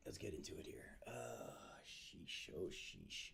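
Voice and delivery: whispered under breath; whispering. Real, not acted. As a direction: mutter it in a whisper. Muttering to himself at computer.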